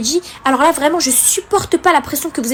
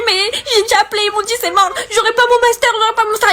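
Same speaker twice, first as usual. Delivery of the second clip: retold despair; 8 semitones higher, faster than usual. Pleading distress to hotline.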